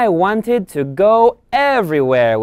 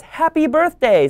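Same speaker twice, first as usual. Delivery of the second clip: excited greeting; 10 semitones higher than usual. Acted. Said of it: "Dad says".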